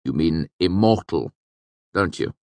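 hesitant, mild query; uncertain. Acted. Scribe correcting a word in the will, uncertain. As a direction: question it tentatively. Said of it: "Chronicler asked uncertainly".

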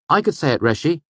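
high, eager chirp; bright. A narrator's reading. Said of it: "Bast said brightly".

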